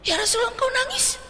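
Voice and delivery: high-pitched, incredulous question; astonished companions. Retold or imitated. Companions seeing the Prophet weep, astonished. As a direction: ask as if you can't believe it. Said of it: "Seolah-olah heran ada Nabi nangis".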